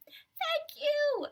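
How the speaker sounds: very high, loud squeaky thank-you; squeaky mice. Acted. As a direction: squeak it like a tiny grateful mouse. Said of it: "they squeaked".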